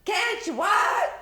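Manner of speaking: high loud slow shout; yelling back. Acted. Stella Rondo yelling down to Mama, high and loud. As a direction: shout it down the stairs.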